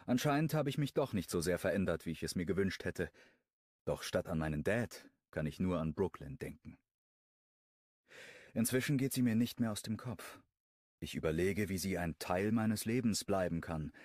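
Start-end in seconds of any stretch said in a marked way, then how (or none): none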